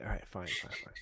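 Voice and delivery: whispered, giving in; grudging whisper. Retold or imitated. Telling how he gave in to his brother, whispering. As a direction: concede it under your breath. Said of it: "I'm like".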